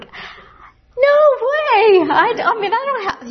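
high, loud burst of disbelief; a startled, laughing no way; squealed refusal. Retold or imitated. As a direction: yelp it out like the idea is absurd. Refusing a radio broadcast offer.

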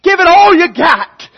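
high, loud battle exhortation; general rallying troops. Acted. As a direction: rouse soldiers before battle. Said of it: "the general would stand before his soldiers".

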